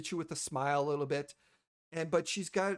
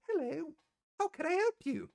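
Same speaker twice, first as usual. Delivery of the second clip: high, bright-voiced dwarven clerk greeting; receptionist voice; 11 semitones higher than usual. GM voicing a dwarven receptionist greeting the party, high and polite. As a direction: chirp a polite welcome from behind the desk.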